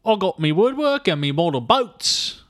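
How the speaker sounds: mock Travolta's bad Cockney; a hammed-up English accent, slowed down; bad British accent. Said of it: "His accent needs to be heard to be believed".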